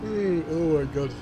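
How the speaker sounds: spoken through a yawn; words stretched by a big yawn. Real, not acted. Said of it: "Sorry for yawning".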